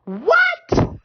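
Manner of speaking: a sudden very high, loud cry of wonder; amazed. Real, not acted. Preacher marvelling at the scripture, high amazed cry. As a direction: shout it in delighted disbelief.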